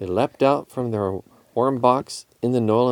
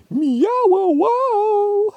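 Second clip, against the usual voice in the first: high, loud cat howl; caterwauling meow-wow-wow; 5 dB louder, much slower than usual. A narrator's reading. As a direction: howl it in rolling syllables. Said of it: "howled Tiger".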